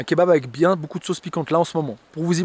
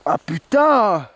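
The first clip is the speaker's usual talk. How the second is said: pained groan; 3 semitones higher, 4 dB louder, much slower than usual. Real, not acted. Mouth burning from chili.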